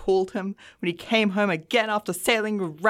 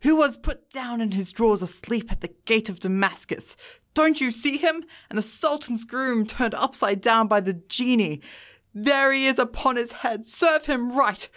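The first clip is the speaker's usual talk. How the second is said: between laughing and crying; 2 semitones higher than usual. A narrator's reading. Scrooge reliving his boyhood storybook heroes, excited and earnest. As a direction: exclaim in earnest delight, close to laughter and tears. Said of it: "extraordinary voice between laughing and crying".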